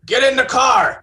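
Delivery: high, loud urgent command; shouted order. Acted. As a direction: yell it at them to hurry. Urgent order during chase.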